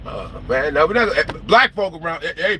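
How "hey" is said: usual speaking voice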